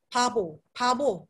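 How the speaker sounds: pabo slowly, starting on a light airy P; Korean pabo. Shown as a demonstration. Teacher quoting the drama word, airy slow pabo. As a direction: keep the first lip closure of pabo thin. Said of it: "Tidak perlu tebal".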